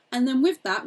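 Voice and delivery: ordinary delivery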